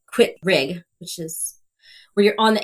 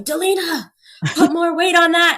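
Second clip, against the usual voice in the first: high, raised retold coach's yell; coach calling across gym; 9 semitones higher, 4 dB louder than usual. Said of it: "I could hear him from across the gym".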